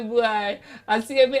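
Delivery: normal talking voice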